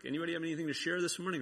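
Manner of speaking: quick breezy question from the brothers; lightly mimicked cheerful group prompt; rattled-off question.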